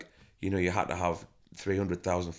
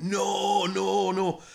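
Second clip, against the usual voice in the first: much higher, louder protest; 12 semitones higher, 5 dB louder, much slower than usual. Retold or imitated. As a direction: cry out no in disbelief. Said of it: "He went".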